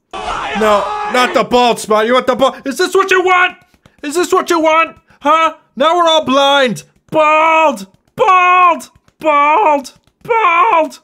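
high, loud, hammed-up wailing; mock outraged screaming. Streamer protesting the bald spot to viewers, screaming in mock outrage. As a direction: build from protest to stretched, screeched one-word cries.

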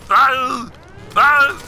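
high, loud action-hero "ah"; short pained hero cries, pitched high; hammed-up yell. Player under attack in the game, high mock yells. Hit during the game fight.

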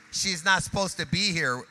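loud, driving pulpit call to praise; rally the church to its feet; full-force preacher; preaching at full force. Acted. Preacher exhorting crowd.

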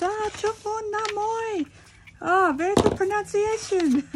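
high, loud teasing impression of brother; imitating her brother. Brother on the phone praising her Vietnamese, imitated. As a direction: mimic him praising your pronunciation. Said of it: "He goes".

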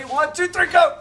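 loud rising count; shouted count-off. Real, not acted. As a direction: bark out the lift count. Count-off for lifting a beam.

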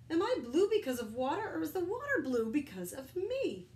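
loud, high playful riddle; high, loud cartoon musing; silly crayon. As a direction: toss off a goofy puzzle. Crayon jokes in water.

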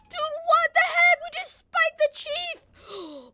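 high, loud, stunned, then dragged slowly; shocked disbelief. Real, not acted. Officer realizing he spiked the chief, high shocked cry. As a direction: cry out in horror at the mistake.

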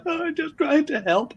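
very high-pitched whiny imitation; mock upset ex. Upset ex saying she only wanted to help, mock hurt. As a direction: plead in a squeaky mock-hurt voice. Mimics upset ex defending herself.

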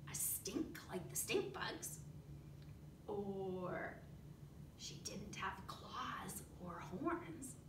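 soft hushed whisper. Real, not acted. Teacher whispering to the children. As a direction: keep it low and secret.

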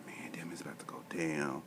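hushed, breathy whisper to himself. Retold or imitated. Whispering his thought as he's about to lose it at the Apple store. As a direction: whisper it like a secret dread. Inner thought before blowing up.

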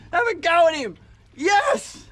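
high, loud shouts choking with happy tears; real overjoyed shouting; tearful joyful shouting. Real, not acted. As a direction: yell in triumph close to crying. Celebrating the catch.

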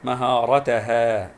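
مهارتها spelled out very slowly, both long a vowels held; slow syllable-by-syllable مهارتها; drawn-out syllables. Shown as a demonstration. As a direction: stretch مهارتها syllable by syllable to show the alif. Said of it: "أيضًا في الهاء".

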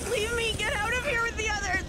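high, loud plea to be left; desperate shout. Acted. Taylor begging her rescuer to leave her, shouting. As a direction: scream at them to save themselves.

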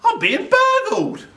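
very high, loud indignant cry; outraged disbelief. A narrator's reading. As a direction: exclaim in shocked outrage. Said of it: "says Burglar Bill".

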